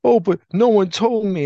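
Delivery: hammed-up wounded excuse; mock upset. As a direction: imitate a childish complaint, pitched right up. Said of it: "now he's upset".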